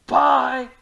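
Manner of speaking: ordinary talk, nothing marked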